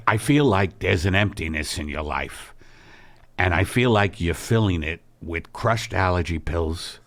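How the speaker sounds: a low, earnest worried husband; Al's low, concerned voice. Acted. Husband voicing concern about wife's emptiness, low and grave.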